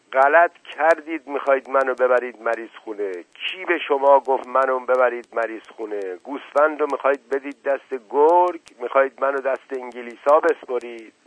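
loud, angry protest; roaring outburst. A narrator's reading. Sick uncle refusing to be handed to the English, roaring. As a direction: bellow at them in outrage. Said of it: "سپس نعره زد".

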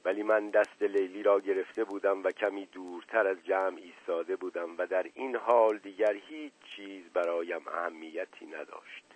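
usual speaking voice

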